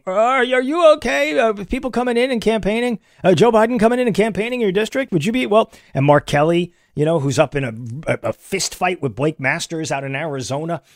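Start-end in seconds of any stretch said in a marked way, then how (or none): 0.1-5.7 mock reporter question. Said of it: "the question became".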